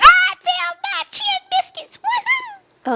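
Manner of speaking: high, joyful squeal; excited cheer. Real, not acted. Streamer finding her lost vape, cheering high. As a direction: squeal it out in triumph.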